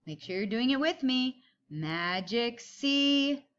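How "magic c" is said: drawn-out slow Magic C; slow tracing call. Shown as a demonstration. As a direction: stretch it slowly while tracing. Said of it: "everybody get your pencil ready".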